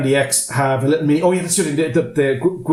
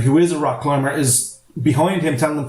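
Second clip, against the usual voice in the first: usual speaking voice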